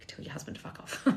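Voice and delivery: whispered, half laughing; whispered aside. Real, not acted. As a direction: drop to a whisper for the insult. Aside to chat, breathy.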